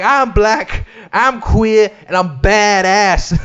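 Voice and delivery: high, proud, slow mock boast; mock defiant declaration. Comedian ending documentary with defiant mock boast. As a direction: strut it out defiantly. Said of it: "what did she say? Like".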